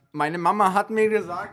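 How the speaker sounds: lightly voiced little kid; like a shy small child. Spielt kleines Kind im Interview. (ein bisschen wie ein kleines Kind)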